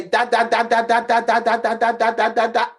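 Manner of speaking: a lightly voiced baby saying da da; baby babble. Little son babbling his first words, lightly voiced. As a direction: bounce the syllables like a toddler.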